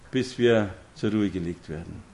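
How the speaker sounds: ordinary delivery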